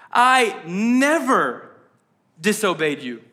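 overstate it, leaning long on "never"; hyperbolic child. Acted. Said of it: "classic hyperbolic child".

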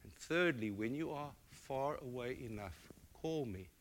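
mother holding her son's face, speaking gently; soft, earnest mother. Retold or imitated. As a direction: speak gently to a small child. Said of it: "she said".

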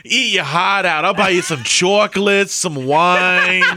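hammed-up New York accent; pleading New Yorker's accent. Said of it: "New York is the least sexy".

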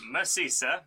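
put-on French accent for a character. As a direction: speak like a hammy Frenchman. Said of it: "say that in a French accent".

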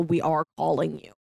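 usual speaking voice

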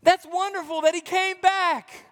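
overjoyed brother rejoicing; joyful news. Pretend. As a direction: gush with happiness at the return. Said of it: "hear the news and go".

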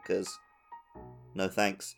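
ordinary talk

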